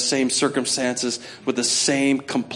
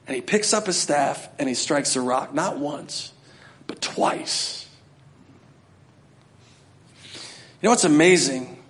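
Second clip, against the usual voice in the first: usual speaking voice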